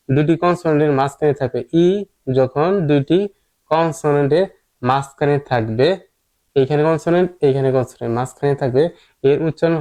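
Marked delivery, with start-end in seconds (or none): none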